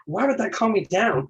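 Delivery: high-pitched retold cartoon outburst; mock freak-out. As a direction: imitate a worked-up cartoon turtle protesting. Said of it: "it's just freaking out".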